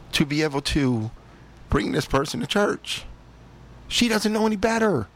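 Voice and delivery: the other voice in his head demanding he take his daughter to church; stern inner voice. Retold or imitated. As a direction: challenge yourself like a scolding conscience. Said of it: "And then the other one's saying".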